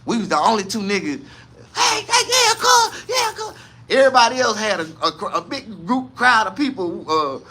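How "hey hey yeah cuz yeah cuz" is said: high calling out to the stage; cheering at graduation. Retold or imitated. Cousin calling out to his daughter, high cheering. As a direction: cheer her name from the crowd.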